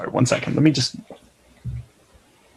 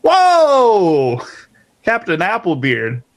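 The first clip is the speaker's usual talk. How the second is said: big surprised whoa; much slower than usual. Acted. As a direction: throw up a startled whoa. Same reaction redone louder.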